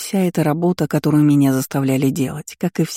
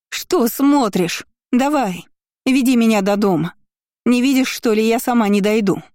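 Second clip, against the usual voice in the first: higher, impatient commands; bossy anger; 7 semitones higher than usual. Acted. Kiora snaps orders at her cousin, higher and impatient. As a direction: order her about, annoyed.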